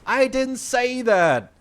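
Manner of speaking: loud, high, drawn-out protest. Retold or imitated. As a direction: re-enact a heated denial, stretching it out loud and high. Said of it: "I raised my voice".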